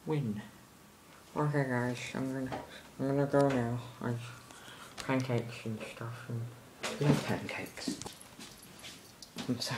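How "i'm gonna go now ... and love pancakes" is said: ordinary talk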